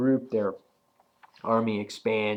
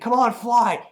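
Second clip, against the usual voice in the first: urgent plea; 11 semitones higher, 6 dB louder than usual. Retold or imitated. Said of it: "he's like".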